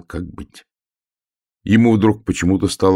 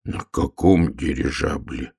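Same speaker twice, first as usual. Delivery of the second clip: low, slow, sinking voice; crestfallen; 4 semitones lower, slower than usual. Acted. Bear cub hears of the airship journey, crestfallen. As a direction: ask it with the heart sinking. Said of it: "упавшим голосом спросил медвежонок".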